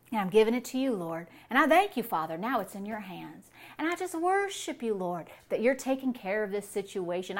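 hushed, reverent thanks to the Lord; prayer. Shown as a demonstration. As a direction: hand it over in quiet worship. Models a quiet prayer.

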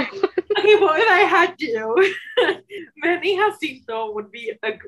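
giggling through the words; laughing. Real, not acted. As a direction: laugh while answering. Laughing through a fan-cast answer.

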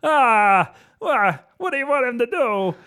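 very high, loud exasperated director voice; Irvin Kershner impression. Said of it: "That's how he talks".

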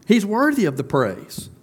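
rising, passionate pulpit climax; preaching at full force. Real, not acted. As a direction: drive the point home to the congregation.

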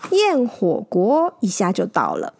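usual speaking voice

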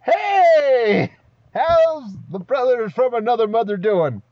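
excited greeting. Acted. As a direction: shout a big friendly welcome. Player greets Azer loudly.

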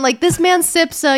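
ordinary delivery

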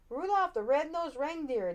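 mock the name like playground bullies; jeering. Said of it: "they jeered".

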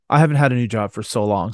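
normal talking voice

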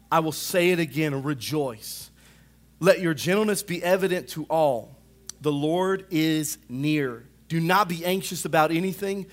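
scripture read rising to a cry; scripture reading building to preaching. Acted. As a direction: build the verse from steady reading into full preaching. Reads Philippians aloud, building.

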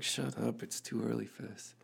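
low, soft, groggy brush-off; grumpy sleepy mumble. Retold or imitated. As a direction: grumble it like you're half asleep. Groggy refusal early in van.

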